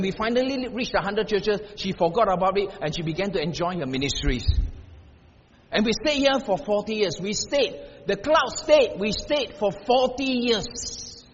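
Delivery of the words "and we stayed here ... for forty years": rising preacher's push, ending slow on forty years; climbing sermon emphasis; building emphasis. Real, not acted. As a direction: drive it home, then stretch the last line.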